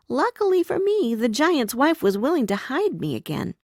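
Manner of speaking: ordinary delivery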